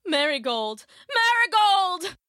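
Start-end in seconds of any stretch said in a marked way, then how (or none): 1.1-2.2 drawn-out, loud high cry; desperate cry. Acted. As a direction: wail for a lost daughter. Desperate call to lifeless daughter.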